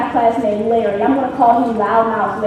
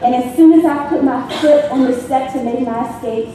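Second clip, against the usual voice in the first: ordinary talk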